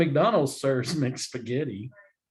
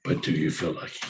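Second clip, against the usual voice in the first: Clint Eastwood; 9 semitones lower than usual. Pretend. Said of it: "my Clint Eastwood face".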